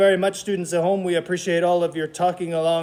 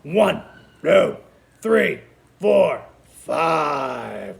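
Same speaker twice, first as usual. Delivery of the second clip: loud playful chomping count; lightly voiced crocodile counting chomps; 3 semitones lower, 4 dB louder, much slower than usual. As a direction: snap out each number like a hungry crocodile. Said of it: "[name], give us five num-nums".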